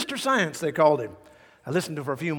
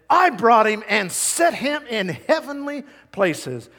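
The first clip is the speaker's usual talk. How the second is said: loud, high, slow proclamation; forceful preaching; 6 semitones higher, 7 dB louder than usual. Real, not acted. Preacher proclaiming resurrection power to congregation, forceful preaching. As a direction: proclaim it, hammering each phrase.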